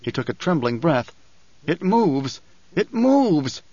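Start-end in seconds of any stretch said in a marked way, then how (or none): 1.7-2.4 higher-pitched, contained exclamation; first cry of dread, held back a little; awed alarm. A narrator's reading. Frightened cry after trembling breath. 2.8-3.6 much higher, intense exclamation; acted outburst leaping up in pitch; alarmed cry. A narrator's reading. Same cry repeated, more intensely.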